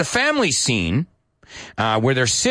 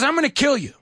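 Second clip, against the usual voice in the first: high mock threat; 13 semitones higher than usual. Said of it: "So he says".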